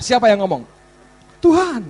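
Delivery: usual speaking voice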